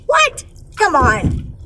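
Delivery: high-pitched disbelieving what; incredulous. Real, not acted. Reacting to someone pleased with a deadbeat, incredulous.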